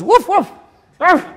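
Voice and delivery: high yapping woofs, faked badly; high mock woofs from inside a sack; mock dog barking. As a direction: bark like a dog to fool the guards. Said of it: "he goes".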